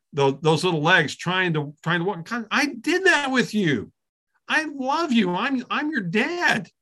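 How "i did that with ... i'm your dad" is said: put-on higher, slow and gentle, like a dad to his toddler; tender father voice. Teacher voicing God as a loving dad to a child, tender and slow.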